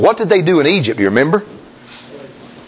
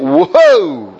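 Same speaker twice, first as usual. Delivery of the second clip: ordinary talk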